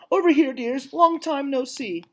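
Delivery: put-on a doting old lady greeting friends; delighted old dog. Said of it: "in a delighted voice".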